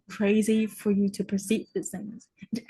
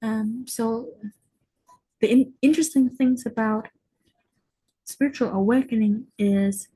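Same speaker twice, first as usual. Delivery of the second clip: ordinary delivery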